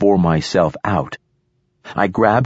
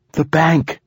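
high, urgent cry, moderately raised; shouted; 10 semitones higher than usual. A narrator's reading. Companion to narrator as she is helped up, shouted. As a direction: call it out high over the river. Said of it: "she shouted as I helped her".

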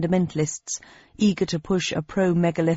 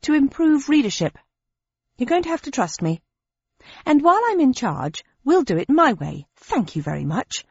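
defensive; 7 semitones higher than usual. A narrator's reading. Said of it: "I remarked defensively".

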